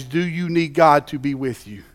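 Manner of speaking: ordinary delivery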